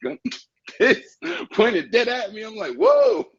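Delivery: rising high in disbelief, laughing; shocked. Retold or imitated. Telling how a gun was pointed at him, high shocked voice. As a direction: voice climbing in shock.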